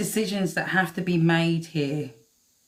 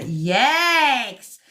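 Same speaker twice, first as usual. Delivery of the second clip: excited yes; 6 semitones higher, 5 dB louder than usual. Real, not acted. Excited agreement mid-reading.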